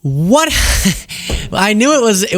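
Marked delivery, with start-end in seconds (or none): none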